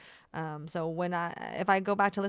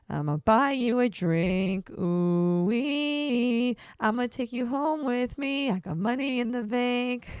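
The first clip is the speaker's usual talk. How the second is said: auto-tune; 4 semitones higher, 4 dB louder, slower than usual. Shown as a demonstration. Testing auto-tune effect on voice.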